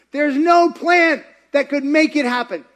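high, loud protest; impassioned objection. Acted. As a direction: cry out the doubter's protest. Voices another doubter's objection.

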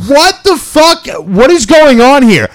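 high-pitched retold outburst; acted disbelief. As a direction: exclaim it high, replaying your shock. Retelling own shocked reply to stranger.

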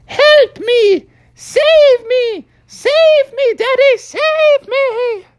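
very high, loud tiny-creature cry; drawn-out high bleating plea; little voice crying for help. Acted. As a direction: squeal for rescue like a little animal. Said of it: "he kept hearing the voice".

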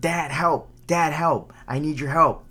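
half-hearted scream to dad for rescue; mild mock scream. Drowning child to dad, mild mock scream. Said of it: "you're screaming out".

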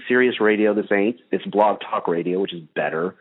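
ordinary delivery

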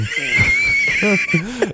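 high, squealing burst of laughter; hooting laugh after the livestream gag; shrieking laugh. Real, not acted. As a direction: cackle at your own joke. Big laugh after the bit.

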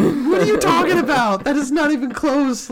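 high, loud disbelief; acted incredulity. Answering co-hosts who praised the bad impression, loud incredulity.

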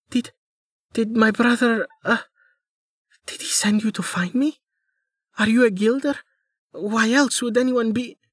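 high, pleading girl playing injured; a young woman faking helplessness, high and breathy with need; pitiful young woman. Amelia questioning the man.

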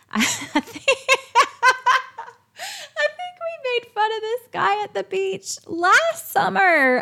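words breaking up with laughter; laughing through words. Real, not acted. Recalling mocking a hairy man at the beach, laughing.

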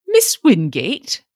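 dumbfounded at finding her; blank exclamation. A narrator's reading. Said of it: "he exclaimed blankly".

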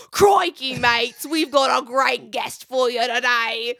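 hammed-up Australian host greeting, loud start; a loud, jokey Australian opener; mock Australian. Podcast host greeting interview guests in hammed-up Australian accent. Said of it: "normally just being like".

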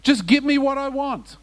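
high-pitched imitation of a demanding believer; mock selfish demand. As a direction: demand it like a spoilt taker, hammed up. Mimics a grasping, materialist believer.

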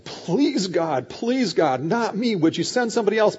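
acted plea with a high-pitched "please"; pleading. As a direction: beg to be let off. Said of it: "is pleading with God".

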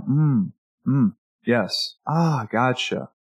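slow, calm listening responses; calm demo of attentive murmurs. Shown as a demonstration. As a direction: acknowledge gently, unhurried, letting them keep talking. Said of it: "Very calm".

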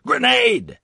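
high urgent yell; shouting. Acted. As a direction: yell the warning. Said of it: "Adam shouted".

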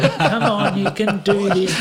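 high, laughing encouragement. Real, not acted. Dad laughs retelling how he urged his son on. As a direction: laugh through the pep talk.

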